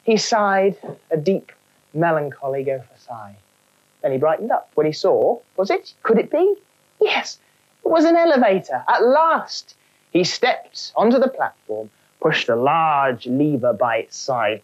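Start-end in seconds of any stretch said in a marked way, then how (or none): none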